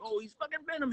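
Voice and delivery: high-pitched mock fan excitement; excited fan reaction. Fan spotting Venom in the trailers, excited. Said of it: "and you're like".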